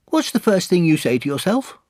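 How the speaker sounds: very high, curious little voice; Piglet. Acted. Piglet questioning Pooh, high-pitched. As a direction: ask a friend in a piping voice.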